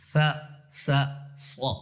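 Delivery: فا said plain, سا with a whistle, صا heavy and full; thin fa, hissed sa, heavy sa. Shown as a demonstration. Teacher demonstrating three s letters to students. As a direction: hiss the سا and make صا heavy. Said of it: "صاد موٹا پڑھا جا رہا ہے".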